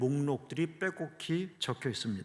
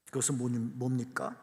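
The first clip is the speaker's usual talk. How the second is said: ordinary talk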